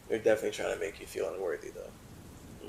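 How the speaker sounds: quick quiet aside; muttered aside. Real, not acted. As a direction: mutter it under your breath.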